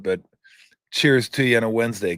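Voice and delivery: normal talking voice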